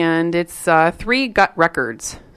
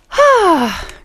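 big "I made it" sigh; relieved sigh; 11 semitones higher than usual. Real, not acted. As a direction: let out a heavy breath on arriving. Settling in, just arrived.

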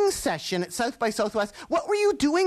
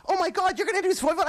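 excited; 8 semitones higher, much faster than usual. Retold or imitated. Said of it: "you're like".

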